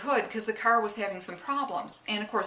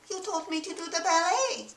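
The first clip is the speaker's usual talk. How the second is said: higher, softer meek wife voice; 9 semitones higher, 3 dB quieter than usual. Acted. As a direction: answer innocently as the wife.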